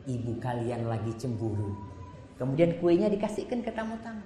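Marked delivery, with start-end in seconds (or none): 0.1-1.7 calm Prophet. Retold or imitated. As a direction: settle the guests gently. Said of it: "Nabi cuma mengatakan".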